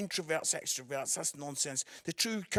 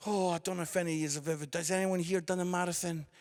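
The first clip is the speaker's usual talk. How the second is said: ordinary talk, nothing marked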